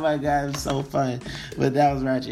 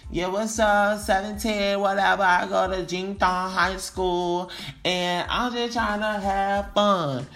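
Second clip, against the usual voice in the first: put-on teen girl voice; 5 semitones higher, 4 dB louder than usual. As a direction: sound young and unbothered. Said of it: "the next one may be like".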